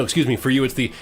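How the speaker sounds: normal talking voice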